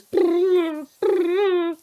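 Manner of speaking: high buzzing brrr imitating a vibrating nudge; buzzing nudge noise. Imitating the nudge alert buzzing at friends, high and buzzy. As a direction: mimic the buzz of the nudge button. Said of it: "all you'd hear is".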